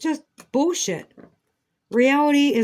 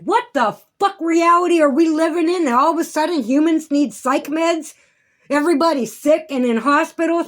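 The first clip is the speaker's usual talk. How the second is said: louder, higher outraged rant; real frustration boiling over; angry rant; 6 semitones higher, 7 dB louder than usual. Real, not acted. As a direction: vent the fury at them. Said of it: "I'm really pissed".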